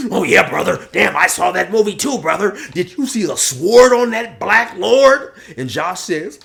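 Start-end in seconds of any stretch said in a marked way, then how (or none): 0.1-5.3 loud, higher-pitched excited bro; Polar Bear. Acted. Buddy raving about the same movie, loud excited bro. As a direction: gush to your buddy with loud enthusiasm. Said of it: "And then Polar Bear says".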